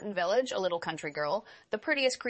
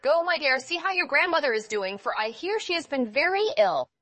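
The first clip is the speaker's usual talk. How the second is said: higher, louder motherly call; a mother sending her girl off, higher and louder; mother's voice; 7 semitones higher, 5 dB louder than usual. Acted. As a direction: call to her daughter brightly.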